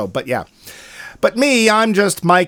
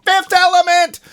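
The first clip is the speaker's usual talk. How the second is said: high, loud gleeful blurt; high, loud excited blurt; mock exclamation; 13 semitones higher, 4 dB louder than usual. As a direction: shout the title like a fan. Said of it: "he somehow didn't just say".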